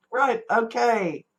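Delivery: normal talking voice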